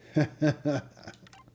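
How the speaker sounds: short real burst of laughter; amused, wordless laugh. Real, not acted. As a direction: laugh out loud between lines. Laughs while reading quiz options.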